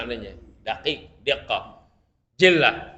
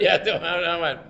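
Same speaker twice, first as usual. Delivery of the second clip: ordinary talk, nothing marked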